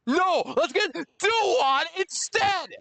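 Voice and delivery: slow, high, sarcastic whine; high slow mocking complaint; mocking rage. Real, not acted. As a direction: drag it out in high mocking disbelief. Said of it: "The same mocking rage voice".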